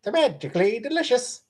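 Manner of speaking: high Irish leprechaun voice. Pretend. Streamer asked for a leprechaun voice, high Irish lilt. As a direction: chirp it like a cereal-box leprechaun. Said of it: "Leprechaun voice".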